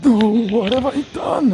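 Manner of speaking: high, stricken gasp of guilt; horrified priest. Acted. Priest horrified at hearing his son. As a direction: realise your own horror. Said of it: "goes wide-eyed".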